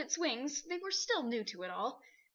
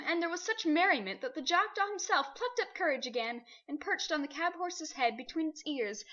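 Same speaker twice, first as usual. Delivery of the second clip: ordinary delivery, nothing marked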